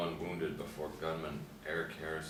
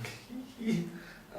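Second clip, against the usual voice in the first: high delighted giggle; gleeful kid laugh. Acted. Boy laughing over comic.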